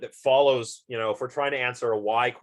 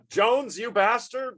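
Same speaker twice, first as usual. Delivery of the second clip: higher, slow mock yell at a coworker; mock angry outburst; 12 semitones higher, slower than usual. Lecturer acting out snapping at Jones over the coffee, mock angry. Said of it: "So I get angry".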